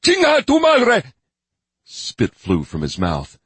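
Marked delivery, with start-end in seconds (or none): 0.0-1.1 high, loud raging shout; enraged scream. Acted. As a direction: curse them with throat-shredding fury. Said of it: "voice betrayed shredding vocal cords".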